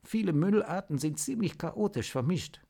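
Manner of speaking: Natascha informs the team, lightly voiced and earnest; serious team leader. Team leader briefing her crew, understated serious. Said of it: "informierte Natascha ernst".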